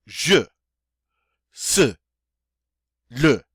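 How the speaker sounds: je, se, le said with a forceful, punched uh vowel; forceful uh sound. Shown as a demonstration. Teacher drilling the uh sound in je, se, le. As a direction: hit the uh in je, se, le hard and loud. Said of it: "forceful sound".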